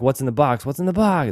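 plead, climbing up; retold desperate what's in the box; desperate cry.

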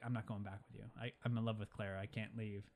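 low, soft retold refusal; quiet confession. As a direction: imitate a subdued, lovestruck admission. Doc confesses love, refuses to return.

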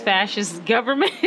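ordinary talk, nothing marked

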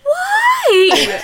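very high, laughing wail; wailing disbelief. Real, not acted. Co-host reacting to accent requests, comic wailing. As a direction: cry out in amused despair. Hears of people ignoring the instruction.

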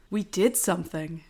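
soft, low-key movie quote; underplay it, softly; calm rendition. Retold or imitated. Friend giving best rendition of Phil's line, calm. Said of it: "here's my best rendition of what he goes".

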